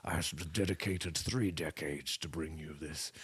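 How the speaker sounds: put-on salty old fella. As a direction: play a weathered old sailor. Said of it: "just a salty old fella".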